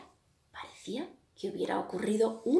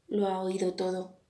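ordinary delivery

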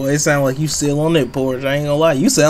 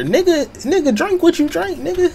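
exasperated; 11 semitones higher than usual. Real, not acted. Exasperated rant at gangster.

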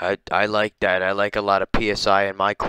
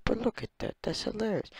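very soft, high-pitched voice; hushed amazement; 9 semitones higher, 13 dB quieter, faster than usual. Real, not acted. Showing a penguin lookalike, hushed high amazement. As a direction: marvel in a tiny hushed voice. Marvels softly at lookalike photo.

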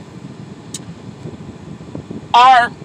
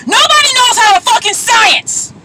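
angry rant; 7 semitones higher, 4 dB louder than usual. Real, not acted. Ranting about people.

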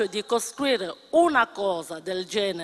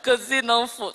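laughing through the words, higher; 5 semitones higher, 4 dB louder than usual. Real, not acted. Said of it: "mi viene da ridere".

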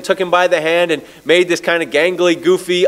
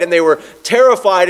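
ordinary talk

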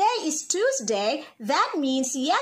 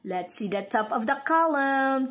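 usual speaking voice

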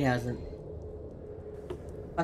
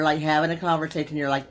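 ordinary talk

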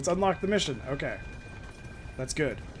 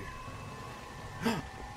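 quick, quiet intake of breath; sharp gasp; 4 semitones higher, 10 dB quieter than usual. Real, not acted. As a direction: suck in air suddenly. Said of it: "Almost choked on my gasp".

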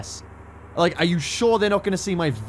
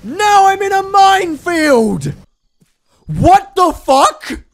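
high, loud, drawn-out exasperated yelling; mad; 13 semitones higher, 10 dB louder, much slower than usual. Real, not acted. Player landed in a minefield, mad shouting. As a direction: yell it slowly, outraged at the game. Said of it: "I'm so fucking mad".

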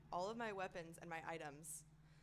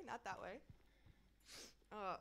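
ordinary delivery

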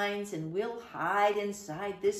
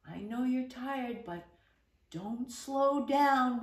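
ordinary delivery, nothing marked

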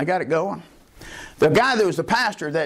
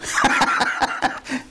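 a high airy burst of laughter; preacher laughing at his answered prayer; high breathy laugh; 10 semitones higher than usual. Real, not acted. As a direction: laugh at the punchline. Laughing at his own story.

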